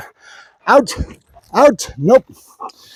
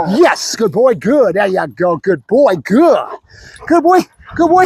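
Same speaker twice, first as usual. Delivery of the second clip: excited praise; 2 semitones higher, slower than usual. Real, not acted. Trainer rewards Bruno for barking, excited praise. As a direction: celebrate the dog's success.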